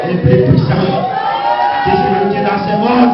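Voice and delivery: loud, forceful proclamation; preacher shouting God's power; preaching shout. Real, not acted. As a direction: hammer the truth home.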